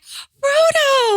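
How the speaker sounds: high excited cry of recognition; excited shout. Retold or imitated. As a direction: yell the name in delight. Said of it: "you're like".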